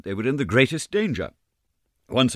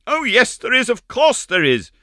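put-on high, loud anxious girl's cry; little Meg crying out in fright; frightened cry; 7 dB louder than usual. A narrator's reading. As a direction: cry out in alarm. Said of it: "cried that little dried plum".

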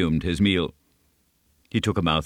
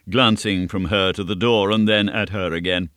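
ordinary delivery

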